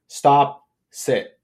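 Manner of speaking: sharp loud command to a dog; loud firm command. Retold or imitated. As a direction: bark it out firmly and loud. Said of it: "really loudly saying".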